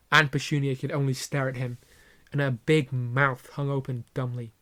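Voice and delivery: usual speaking voice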